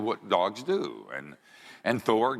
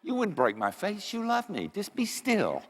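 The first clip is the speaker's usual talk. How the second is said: high, unworried little-dog voice; Annie the dog; 14 semitones higher than usual. Acted. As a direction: answer back in a high, put-on pet voice. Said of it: "Annie says".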